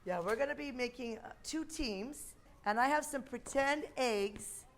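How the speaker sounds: put-on bright, patient voice for small kids; kindergarten teacher voice. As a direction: explain a game to little children. Leads kids' game.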